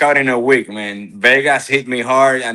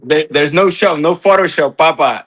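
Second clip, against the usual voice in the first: higher and louder, slowing down on real and live; excited boasting; 6 semitones higher, 5 dB louder than usual. Real, not acted. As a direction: brag it out, hyped up. Bragging about his live skills.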